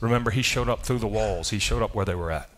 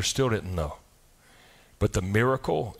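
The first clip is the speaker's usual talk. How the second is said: ordinary talk, nothing marked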